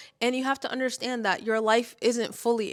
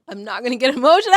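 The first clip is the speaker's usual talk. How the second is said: louder, fast, laughing through tears; tearful laugh; 3 semitones higher, 6 dB louder, much faster than usual. Real, not acted. Vlogger laughing through tears about her mom. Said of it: "I can never talk about my mom and not wanna cry".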